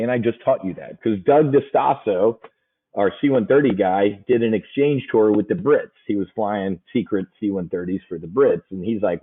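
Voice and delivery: usual speaking voice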